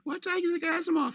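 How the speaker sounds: put-on high child voice asking innocently; curious kid. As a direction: play a puzzled little kid. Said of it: "all the kids are like".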